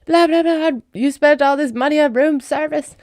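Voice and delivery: high-pitched mock outraged father; hammed-up scolding over a huge bill; Kevin McAllister's dad. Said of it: "I just hear it in the voice of".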